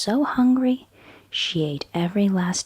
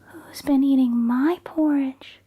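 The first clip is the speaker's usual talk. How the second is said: higher, louder, voiced Mama Bear; medium-sized Mama Bear voice; 6 semitones higher, 4 dB louder than usual. Mama Bear asking after her porridge, in a higher, louder voice. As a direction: step out of the whisper into a motherly voice. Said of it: "cried Mama Bear in her medium-sized voice".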